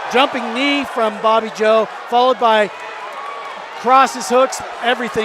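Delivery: loud, rising fight call; excited play-by-play. Real, not acted. Flurry of strikes ending fight.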